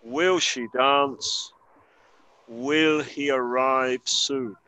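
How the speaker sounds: each word drawn out very slowly; slow model questions. Shown as a demonstration. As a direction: read it slowly for learners to copy. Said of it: "escuchar la pronunciación".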